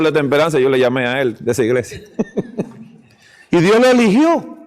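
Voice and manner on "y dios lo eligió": laughing. Real, not acted. Laughing as he tells it.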